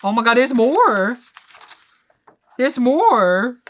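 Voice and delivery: loud, high burst of amazement; excited exclaim. Real, not acted. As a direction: gasp it out like a thrilled fan. Reading reaction, excited outburst.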